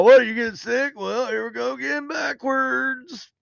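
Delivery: high-pitched announcer, drawing out the last words; carnival ride operator. Acted. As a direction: taunt riders over the PA, stretching it out. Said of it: "he's talking into the fucking microphone".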